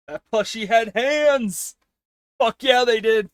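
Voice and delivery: high, loud enthusiasm; excited exclaiming. Real, not acted. Streamer thrilled about a plushie, high excited exclaiming.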